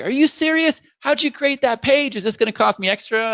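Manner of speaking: high, disbelieving client voice; amazed client. Retold or imitated. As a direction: play the stunned client, pitch way up. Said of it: "He'd be like".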